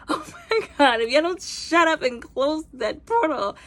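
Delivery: high, loud, laughing scold; laughing exasperation. Retold or imitated. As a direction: laugh while scolding them to shut up. Exasperated at characters on screen.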